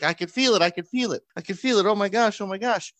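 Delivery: put-on high, breathless kid panic; little girl feeling the bead move, high and anxious; freaking out child. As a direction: gasp it out like a frightened girl. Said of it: "freaking out".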